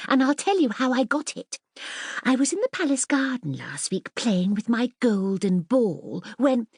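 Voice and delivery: a hint of a haughty child princess; lightly voiced proud little princess. Mirabel boasting to Ellen about her royal slippers, lightly prim.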